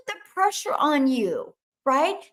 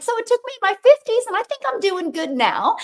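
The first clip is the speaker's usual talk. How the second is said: high, quick, louder self-teasing; playful boast; 9 semitones higher, 4 dB louder than usual. Retold or imitated. Telling a teen she found her path late, playful. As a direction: toss it off with a laugh in the voice. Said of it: "I'm like".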